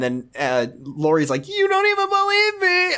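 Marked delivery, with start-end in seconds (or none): 1.5-3.0 very high put-on character whine; badly faked high crying voice; botched cry voice. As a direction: attempt crying but land in cartoon squeal. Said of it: "trying to do cry voice, but I just did Josh voice".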